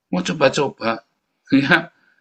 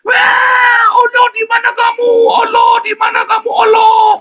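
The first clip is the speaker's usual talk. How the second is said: shrieking, very high and loud desperate wail; a mocking wail calling out to Allah; mock wailing cry; 8 dB louder than usual. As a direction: scream to God in hammed-up panic. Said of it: "sekarang mereka teriak-teriak".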